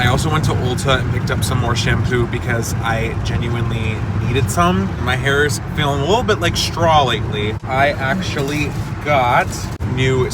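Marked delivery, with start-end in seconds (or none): none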